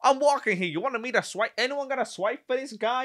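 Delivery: high, pushy New York holler; hammed-up New York street voice; mock New York accent. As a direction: play a loudmouth New Yorker. Acting New Yorkers at the turnstile.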